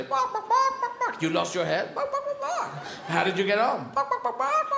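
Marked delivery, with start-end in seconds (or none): 0.0-1.2 high yappy barks; barking dog. Pretend. 1.9-2.9 high yappy barks; barking dog. Pretend. 3.9-4.7 high yappy barks; barking dog. Pretend.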